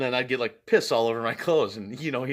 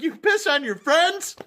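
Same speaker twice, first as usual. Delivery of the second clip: much higher, slow disbelieving shout; incredulous outrage; 6 dB louder than usual. Retold or imitated. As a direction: stretch out the disbelief. Stranger incredulous.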